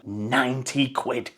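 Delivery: weary scorn at the price; disgusted. Real, not acted. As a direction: drag it out in contempt. Slow disgusted verdict.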